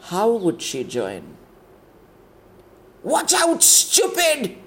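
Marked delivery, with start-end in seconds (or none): 3.0-4.6 shrill high yell; scream. Acted. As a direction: scream a warning at her. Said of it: "A scream broke into her thoughts".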